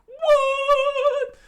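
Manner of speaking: very high, loud falsetto shriek; squeaky scream. Acted. Sanji seeing the Lupins surf, in a squeaky shriek. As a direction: shriek it out in disbelief. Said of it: "Sanji screamed out".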